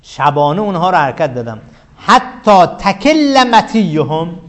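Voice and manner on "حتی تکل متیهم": slow, drawn-out verse chant; reciting Arabic verse. Shown as a demonstration. Teacher recites the poet's verse to the class, slow chant. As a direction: intone the poet's line slowly. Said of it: "سریت بهم یعنی".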